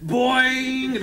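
high imitated spring; spring boing. Zebedee bouncing in to argue, high boing.

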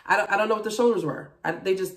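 ordinary talk, nothing marked

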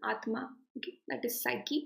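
normal talking voice

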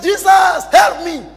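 loud, high desperate plea; preacher imitating a frantic shout; acted cry for help. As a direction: yell out to be rescued. Drunk man cries out for rescue.

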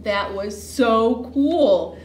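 loud, slow and drawn out; play-acted big, slow enthusiasm; thrilled exclamation. A narrator's reading. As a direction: stretch it out in delighted awe. Thrilled horse after the crash.

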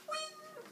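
soft, very high "wing"; sneaky little high sound effect; imitated scythe noise. Shown as a demonstration. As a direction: let out a small high-pitched blade zing.

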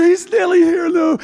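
half-crying alarm as time runs out; frantic cry. Acted. Martha sees Jesus arriving.

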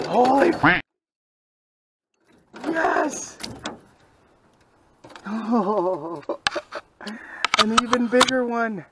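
high, loud excited yelps at the catch; real excitement. Real, not acted. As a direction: yell out in pure thrilled joy. Catches a big fish at dusk.

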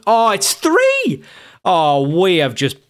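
high, drawn-out groan of disbelief; dismayed cry. Real, not acted. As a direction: wail at the third goal going in. Conceding a third goal live.

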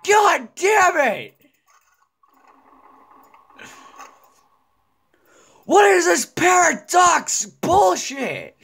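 drawn-out high furious yell; high slow gamer rage; slow angry shout. Real, not acted. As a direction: stretch the curse out in rage. Gamer raging at game.

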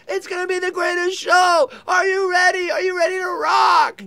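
high, shouted hype; arena rock announcer. Retold or imitated. Singer hyping a stadium crowd before the show, high mock shout. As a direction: pump up the crowd at full volume. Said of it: "He goes".

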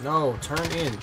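ordinary delivery

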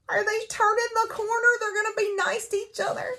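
high, fast, squealing mock excitement; giddy hope. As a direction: gush like a fan whose ship might sail. And you're like.

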